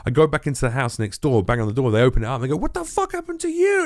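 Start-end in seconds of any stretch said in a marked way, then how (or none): 2.5-3.8 very high, aghast imitation of neighbours; mock shock. Man imitating neighbours opening the door to him, high shocked voice. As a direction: squeal it in falsetto, appalled.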